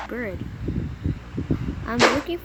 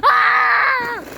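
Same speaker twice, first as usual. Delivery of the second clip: furious scream; 10 semitones higher than usual. Real, not acted. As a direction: scream at the game. Gamer crashes at the end.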